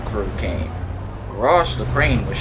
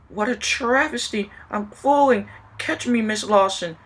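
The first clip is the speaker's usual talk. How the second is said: higher, alarmed cries; panicked; 9 semitones higher than usual. Acted. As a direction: squeal as she falls. Falling lady cries out.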